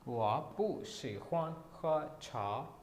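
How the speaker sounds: slow, deliberate Mandarin negative sentence; slow Chinese demo. Shown as a demonstration. Teacher to students: slow Mandarin negative sentence. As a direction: lay out every syllable clearly. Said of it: "मैंने एक negative sentence बनाना था".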